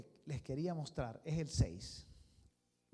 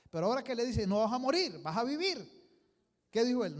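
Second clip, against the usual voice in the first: loud preaching; 6 semitones higher, 9 dB louder, faster than usual. Acted. Preacher building to climax.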